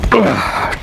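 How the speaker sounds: short strained grunt on the last rep; effort grunt. Real, not acted. Coach finishing his last curl with a strained grunt. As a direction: grunt as you push the final curl.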